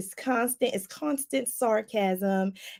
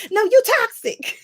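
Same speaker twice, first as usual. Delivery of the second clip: sassy retort; 11 semitones higher, 7 dB louder than usual. Acted. Scolding a spoiled sixty-year-old.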